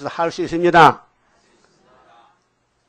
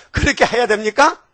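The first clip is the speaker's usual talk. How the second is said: ironic question; 6 semitones higher than usual. Acted. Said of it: "아니지요".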